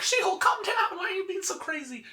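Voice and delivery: a high-pitched yell of alarm, begging She-Hulk to calm down; alarmed shout. Retold or imitated. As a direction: shout up high to stop her. Heroes yell at rampaging She-Hulk.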